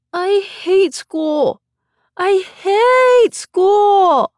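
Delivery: high, long drawn-out whine; whining child. A narrator's reading. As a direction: stretch every word into a little boy's whine. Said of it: "Rainey would whine".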